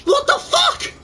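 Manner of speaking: very high-pitched real panic; horrified shriek. Real, not acted. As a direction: shriek it in horror at the monster. Horrified at monster on screen.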